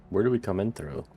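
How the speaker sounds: ordinary delivery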